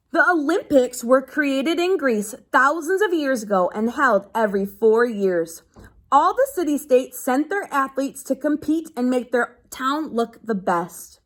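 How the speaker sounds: steady read-aloud to a class; reading to children. Acted. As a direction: read the page out to the kids. Teacher reads a book aloud.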